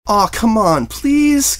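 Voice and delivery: high, pleading filly whine; a young filly's drawn-out please; whining. A narrator's reading. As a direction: beg like a kid who wants her story told. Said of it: "Scootaloo whined".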